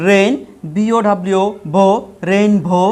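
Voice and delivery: Rain and ভো as separate chunks with pauses, then joined as rainbow; ভেঙে ভেঙে বানান. Shown as a demonstration. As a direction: break the word up for pupils, lifting rain, then blend it. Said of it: "আমরা ভেঙে ভেঙে বানান করবো". (Rain, ভো থেমে থেমে আলাদা, শেষে রেইনবো একসাথে)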